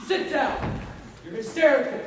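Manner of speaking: husband loudly ordering his wife to sit; angry command. Acted. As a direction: order her down.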